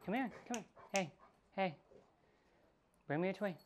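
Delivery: high-pitched coaxing call; short, high calls to a pet. Real, not acted. Calling a pet to play.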